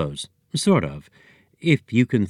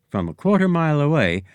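ordinary delivery, nothing marked

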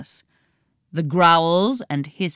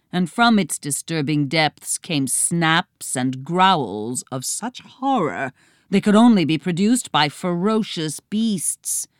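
ordinary delivery, nothing marked

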